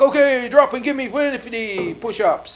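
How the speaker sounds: loud, high drill-coach order; barking coach. Pretend. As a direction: yell it like a gym coach. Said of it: "he's like".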